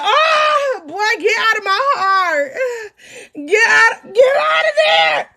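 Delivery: high, hammed-up crying shout; mock wailing heartbreak. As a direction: wail it like a melodramatic broken heart. Mock heartbreak at ex's message.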